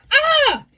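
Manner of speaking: high, loud cry of fright; startled yell. Acted. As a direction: jump at a sudden shape. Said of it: "Jiminy yelled".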